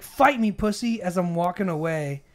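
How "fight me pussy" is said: louder, higher goading challenge; retold shouting. Drunk man goading him as he walks away, retold shout. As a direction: imitate a man shouting for a fight. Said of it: "starts shouting at me".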